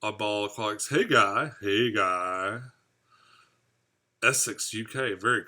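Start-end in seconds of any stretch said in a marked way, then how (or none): 1.6-2.6 lightly put-on voice. Streamer repeating a viewer's greeting, lightly put-on voice. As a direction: repeat the hello in a lightly silly voice.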